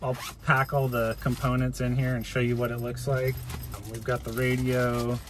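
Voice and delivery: usual speaking voice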